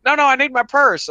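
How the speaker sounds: high, loud insistent no; panicked lady. Retold or imitated. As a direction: mimic her frantic refusal. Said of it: "She goes".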